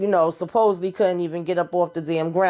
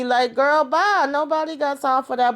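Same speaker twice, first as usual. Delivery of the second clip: exasperated brush-off; 9 semitones higher than usual. Real, not acted. Speaker rants about Lily's request.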